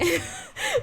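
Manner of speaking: sudden squeaky high giggle. Real, not acted. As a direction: burst into a high-pitched giggle. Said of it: "I sound like a pick-me girl".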